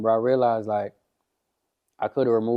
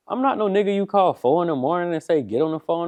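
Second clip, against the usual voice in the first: pitched-up angry telling-off; indignant retort; 7 semitones higher than usual. Retold or imitated. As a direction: rant at the caller who woke him. Retold angry refusal.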